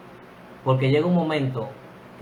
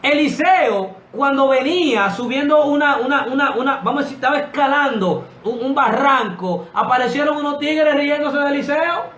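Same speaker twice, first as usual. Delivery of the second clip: high, loud preacher's cry; fiery preaching; 12 semitones higher, 6 dB louder than usual. Acted. Preacher stirring up his congregation, loud fiery preaching. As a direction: preach it to the rafters, full force.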